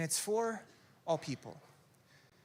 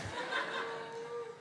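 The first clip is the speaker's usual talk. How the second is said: high giggle; 8 dB quieter than usual. Real, not acted. Laughing at his impression.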